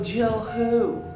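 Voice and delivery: mock confusion at a stranger; hammed-up who-are-you; baffled. Retold confused phone reply.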